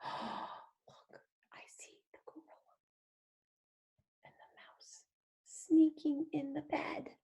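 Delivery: soft breathy unvoiced whisper. Teacher whispering as animals sneak into bed. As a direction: whisper so you don't wake them.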